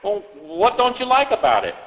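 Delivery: usual speaking voice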